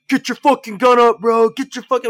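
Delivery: high, loud yell mocking range hard-asses; range-bully yelling. Shooter imitating dudes on the range pressuring trainees, mock yelling. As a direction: bellow it like an overbearing range guy. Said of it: "it's like those dudes on the range".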